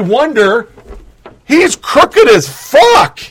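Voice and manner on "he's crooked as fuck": slow, drawn-out furious shout. Real, not acted. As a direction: yell it out slowly in disgust. Angry rant about Trudeau.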